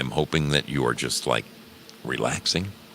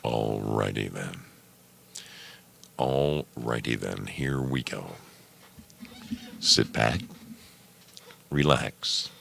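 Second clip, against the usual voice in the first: guided meditation voice; 5 semitones lower, 6 dB quieter than usual. Acted. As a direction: ease them into calm. Lowers into calm guided voice.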